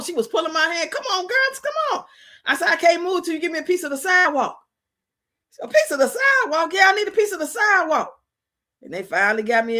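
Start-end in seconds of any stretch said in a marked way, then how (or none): 0.9-2.0 lightly voiced friend, much higher, urging; a hint of a friend tugging her along; coaxing friend. Friend pulling her hand toward the house, coaxing high. 2.7-8.1 higher, faster, lightly acted dazed pleading; half-hearted stoned insistence on the sidewalk; mock stoned plea. High woman begging friends at the curb, mock-dazed higher.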